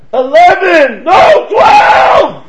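very high, loud mock yelling; shouted argument. Two old men still arguing at the bus stop, shouting. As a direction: holler it out, stretching the last word.

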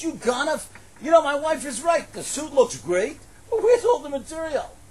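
loud, high accusing king; angry king. Acted. King confronting the tailor about missing cloth, loud and angry. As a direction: scold the tailor as a furious ruler.

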